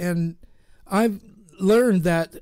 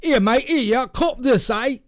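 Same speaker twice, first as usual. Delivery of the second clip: hammed-up Australian accent, higher and louder; 5 semitones higher, 3 dB louder than usual. Host mocking Americans in Australian accent, loud. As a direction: play the mock Aussie bloke, Australian twang. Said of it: "you really do need to start practicing your, your accent".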